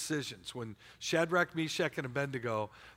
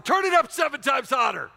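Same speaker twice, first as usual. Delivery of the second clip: much higher, much louder royal command; enraged king roaring at his guards; furious royal shout; 11 semitones higher, 12 dB louder than usual. Acted. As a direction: bellow the king's order. King orders furnace heated.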